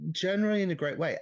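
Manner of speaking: playful echo. Acted. Echoing the co-host's phrase back, loud and playful. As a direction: throw the phrase back at him with glee.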